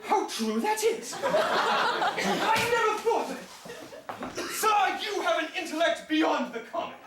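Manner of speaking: slow, high, laughing sarcasm; laughing mock praise. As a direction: heap sarcastic praise on him while laughing.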